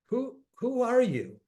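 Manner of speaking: retold startled question. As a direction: ask it as if spooked that he knows. Retold stranger startled by his guess.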